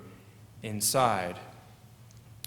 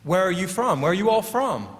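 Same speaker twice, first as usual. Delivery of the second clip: imitating an inquisitive server, pitched higher; lightly acted higher-voiced questioning; curious server; 6 semitones higher, 3 dB louder, faster than usual. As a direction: ask like a server who has overheard an accent. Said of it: "And they'd ask".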